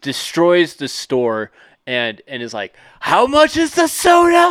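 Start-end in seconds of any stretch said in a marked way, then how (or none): 3.0-4.5 very high-pitched, loud mock scream; meltdown shout. Customer to cashier after destroying the store, acted screaming meltdown. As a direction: screech it like someone in total meltdown. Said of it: "a colossal fucking meltdown".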